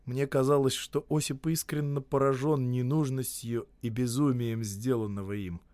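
usual speaking voice

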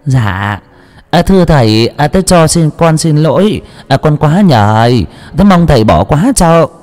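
high, hesitant, ingratiating pleading; fake humble apology. A narrator's reading. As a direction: grovel without meaning it. Mistress feigns humble apology.

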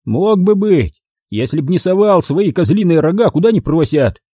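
put-on high, sneering young thug voice; mocking thug. Thug sneers at the beaten narrator in a high mocking voice. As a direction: jeer down at the old man on the ground.

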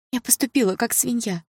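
high, small remorseful voice; wilting self-reproach; crestfallen. A narrator's reading. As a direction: admit it deflated, in a high voice. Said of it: "поникла девушка".

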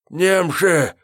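high panicked yell; enemy spotted shout. A narrator's reading. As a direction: scream the warning again. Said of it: "повторил он". (истошный крик о немцах)

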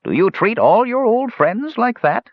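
very high, call from afar. A narrator's reading. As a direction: call across the square as an old elf. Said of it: "staying at a distance, but calling out".

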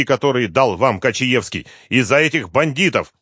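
loud, high rousing tirade; impassioned shouting. A narrator's reading. Detective berates the dying man, loud and high. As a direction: shake him out of his despair. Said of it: "крикнул Дронго".

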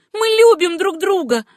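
high, loud, impassioned claim; heated outburst of love; defiant declaration. A narrator's reading. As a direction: cry it proudly at the rival. Acted quarrel, defiant answer.

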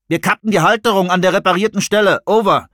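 Finn on the radio. A narrator's reading. Finn reporting to Jones over walkie-talkie, brisk.

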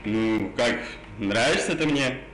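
normal talking voice

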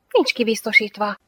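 usual speaking voice

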